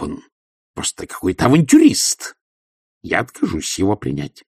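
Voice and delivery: haughty noblewoman scoffing at gossip; imperious old baroness, dismissive; scornful baroness. Acted. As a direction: sneer at the rumours like a proud baroness.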